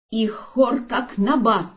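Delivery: usual speaking voice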